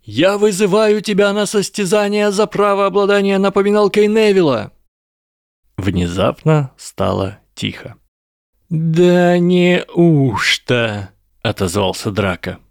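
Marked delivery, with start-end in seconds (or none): 0.0-4.7 high, sustained formal challenge; yell. A narrator's reading. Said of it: "завопил Гарри". 8.7-11.1 very slow, high, stretched-out drawl; loud drawl. A narrator's reading. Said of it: "растягивать слова можно так громко".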